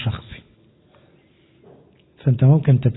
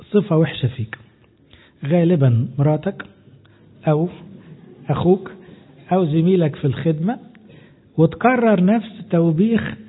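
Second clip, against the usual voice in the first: normal talking voice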